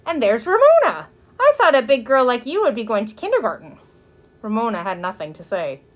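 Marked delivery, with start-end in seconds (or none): 0.0-3.8 put-on high-pitched fussing over a child; effusive neighbor lady, raised voice; gushing neighbor. A narrator's reading. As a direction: talk down sweetly to a little girl. Gushing neighbor talks to little girl.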